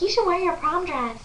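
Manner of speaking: high-pitched imitation of the best friend; retold eager suggestion. Best friend suggests an outfit, high eager voice. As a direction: lift the voice high, urging.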